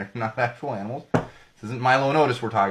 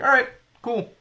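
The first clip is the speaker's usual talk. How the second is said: breezy high all right cool; 13 semitones higher, 5 dB louder than usual. Retold or imitated. Mock casual acceptance.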